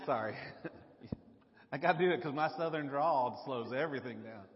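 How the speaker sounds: usual speaking voice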